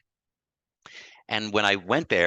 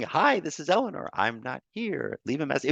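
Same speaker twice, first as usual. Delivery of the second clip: grandma's answering machine greeting; 7 semitones higher than usual. Retold or imitated. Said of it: "the rhyming".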